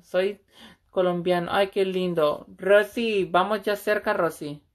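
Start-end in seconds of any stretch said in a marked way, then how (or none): none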